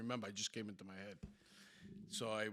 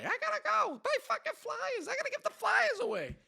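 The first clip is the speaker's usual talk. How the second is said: piercingly high, loud mock nagging; chirping girl; 10 dB louder than usual. Girl in the back seat nagging the driver, loud chirping. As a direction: chirp it loudly, imitating her nonstop fussing. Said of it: "The whole time chirping away".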